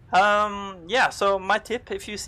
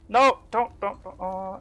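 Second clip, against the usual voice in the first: panicked plea; 3 semitones higher, 4 dB louder, slower than usual. Real, not acted. Pleading with the glitching game.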